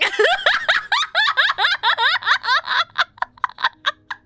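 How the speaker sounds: very high, loud, long laugh; laughing fit. Real, not acted. Reader losing it over her French accent, real laughter. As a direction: laugh helplessly till out of words.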